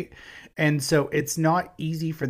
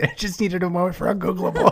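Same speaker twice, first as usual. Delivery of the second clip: giggling through the words; laughing; 7 semitones higher, 4 dB louder, faster than usual. Real, not acted. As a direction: talk while cracking up. Cracking up over a word.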